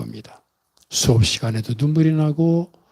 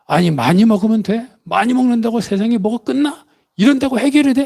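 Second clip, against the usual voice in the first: indignant retort climbing much higher; imitated yell, building to high pitch; retold angry outburst; 7 semitones higher than usual. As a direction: act out a pent-up outburst, pitch rising. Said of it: "막 크게 송일 소리를 쳤답니다".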